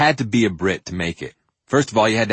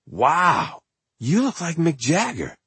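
impressed friend; 8 semitones higher, slower than usual. Retold or imitated. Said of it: "somebody said".

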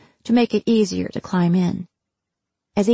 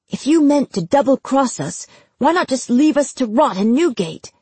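higher, louder threatening challenge; angry accusation; 6 semitones higher, 3 dB louder than usual. Acted. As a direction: hurl it as a threat. Robert confronts the spymaster, hand on sword.

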